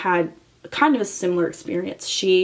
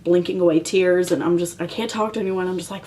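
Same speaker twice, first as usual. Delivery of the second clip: ordinary delivery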